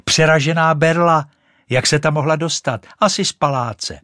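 put-on high, loud boyish exclamation; quick, excited shout at a find; boy calling out. A narrator's reading. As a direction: call it out like a little boy. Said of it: "vykřikl jeden ten klouček".

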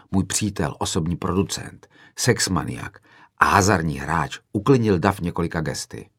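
ordinary delivery, nothing marked

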